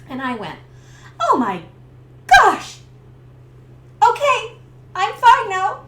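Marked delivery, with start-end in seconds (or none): 1.2-5.8 high, loud flustered gasp; embarrassed, brushing it off. Retold or imitated. Embarrassed relief.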